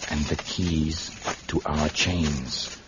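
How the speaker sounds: tief, leise, beschwörender Hypnotiseur; hypnotisierend. Acted. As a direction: leg sie mit tiefer, leiser Stimme in Trance. Commanding the hypnotized guard.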